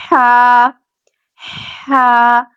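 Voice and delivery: ہا with a raspy breath from mid-throat; harsh breathy ح sound. Shown as a demonstration. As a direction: breathe ہا like after cold ice cream. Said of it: "حلق کے درمیان والے حصے سے".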